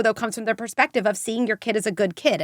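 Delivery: ordinary talk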